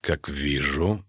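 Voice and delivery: very deliberate low delivery; Каланте, slowly. A narrator's reading. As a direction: begin slowly and coolly. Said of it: "медленно сказала Каланте".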